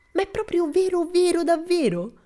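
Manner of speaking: can hardly believe it, squeal with joy; breathless excitement. Acted. Tommy asking Pippi if it's really true, overjoyed squeal.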